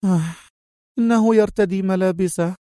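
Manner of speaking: high woman's voice, a touch weary; exasperated sigh. A narrator's reading. Mona explains to the nurse, sighing. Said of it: "زفرت منى قائلة".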